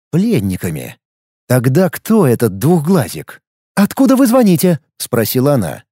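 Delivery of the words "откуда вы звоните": put-on high, tense young woman. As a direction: voice her higher and alarmed. Female character Kaida asking.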